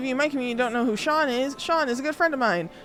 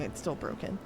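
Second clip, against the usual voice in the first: ordinary delivery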